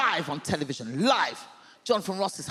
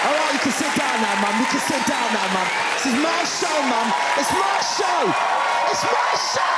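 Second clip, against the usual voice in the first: high, loud telling-off; mock-indignant shouting; 5 semitones higher, 5 dB louder than usual. Real, not acted. Comedian telling mum to sit down, mock-indignant shout. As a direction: reclaim the spotlight in a high shout.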